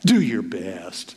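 low, slow dismissive drone; low sneering slow brush-off. Shown as a demonstration. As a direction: drag it out low with scorn. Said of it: "I ain't sending you off to do your best".

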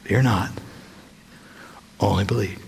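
low, quiet, gentle comfort; tender reassurance. Retold or imitated. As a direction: soothe him softly, very low. Repeated Jesus words.

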